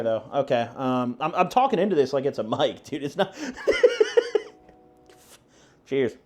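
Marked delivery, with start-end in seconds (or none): 3.4-4.4 high squeaky laugh. Real, not acted. Laughs at talking into clip mic.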